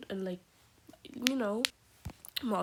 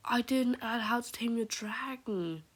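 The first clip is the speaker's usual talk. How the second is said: gasp, then higher-pitched dismay; shocked realization; 4 semitones higher, 4 dB louder than usual. Real, not acted. Fan realizing a favorite film was left off, shocked. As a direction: blurt it out as a sudden horrified realization.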